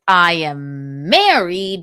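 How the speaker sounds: pointed slow declaration; emphatic. Retold or imitated. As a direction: lay it down firmly, word by word. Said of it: "then I said".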